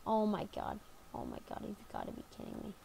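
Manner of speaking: breathy whisper in disbelief; hushed, urgent whisper. Real, not acted. Neighbor close, hiding.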